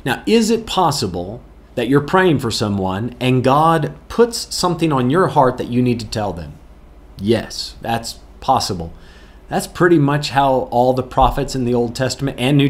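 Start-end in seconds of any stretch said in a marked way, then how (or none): none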